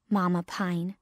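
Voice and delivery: ordinary delivery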